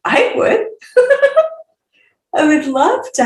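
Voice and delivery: high, laughing I would; excited squeal. Real, not acted. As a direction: squeal it out with a giggle. Eager giggling answer.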